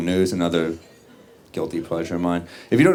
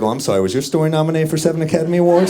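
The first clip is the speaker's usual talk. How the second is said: sarcastic retort; 9 semitones higher, 4 dB louder, faster than usual. Retold or imitated. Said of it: "I was like".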